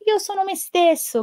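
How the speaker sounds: high, joyful cry; happy shout. Acted. Pezzettino tells his friends, high happy cry. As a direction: announce it with delight. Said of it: "gridò Pezzettino tutto contento".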